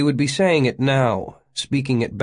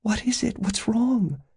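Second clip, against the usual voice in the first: very high, small anxious voice; high, worried pleading; whispered; 13 semitones higher than usual. A narrator's reading. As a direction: ask in hushed alarm. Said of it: "Janet whispered".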